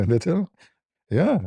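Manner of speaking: high incredulous question. Retold or imitated. Incredulous retort.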